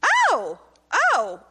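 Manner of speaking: a loud, high mock "oh" of alarm; retold surprise. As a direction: act out his startled double "oh". Husband reacts reading her answers.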